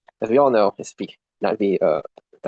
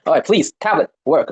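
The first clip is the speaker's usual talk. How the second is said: louder, higher exasperated plea; real frustration, pleading with a device; begging the tablet; 5 semitones higher, 6 dB louder than usual. Real, not acted. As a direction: plead with a stubborn gadget. Begging a misbehaving tablet to work.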